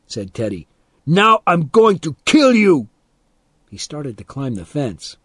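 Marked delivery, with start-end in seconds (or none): 1.1-2.9 high, loud yelled threat; furious threat. A narrator's reading. Boy about to climb the fence, threatening furiously. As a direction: yell it as you go for him.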